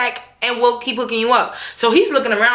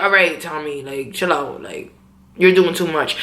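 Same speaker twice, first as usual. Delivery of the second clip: much lower, cool talk-down; calming Ghost; 8 semitones lower than usual. Retold or imitated. Ghost telling Tommy to chill, calming. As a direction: drop low and rein in a hothead.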